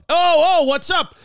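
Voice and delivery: high, loud hail from a van; imitated passerby's holler; calling out. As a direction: call out excitedly to someone crossing the street. Man in van calls out.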